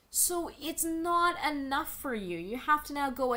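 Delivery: incredulous at the author. Real, not acted. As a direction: sound amazed at their gall. Incredulous at the writer.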